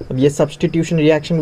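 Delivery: normal talking voice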